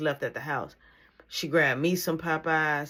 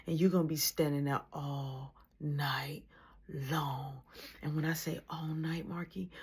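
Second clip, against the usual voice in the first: softer, whispered threat of punishment; menacing whisper; 6 dB quieter than usual. Retold or imitated. Mom quietly sentencing son to the chair, whispered.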